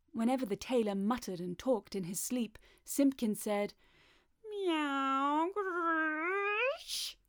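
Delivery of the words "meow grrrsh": high drawn-out meow into growly yowl; horrid cat noises. A narrator's reading. Cat answering the sleeping tailor, strange high yowling. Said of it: "made strange horrid noises".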